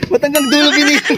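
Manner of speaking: high-pitched, laughing disbelief; laughing question. Real, not acted. Asking a friend why it went so far, laughing. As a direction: ask it through a high laugh.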